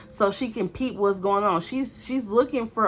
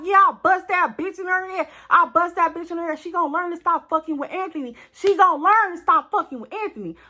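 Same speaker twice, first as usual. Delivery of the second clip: gleeful boasting; 8 semitones higher, 4 dB louder than usual. Retold or imitated. Friend boasting after attack.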